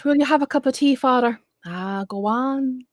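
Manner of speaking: playful southern Irish brogue, pressing the tea on father; light southern Irish accent with a teasing push; mock southern Irish accent. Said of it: "But I don't talk like that, like I come from the south of Ireland".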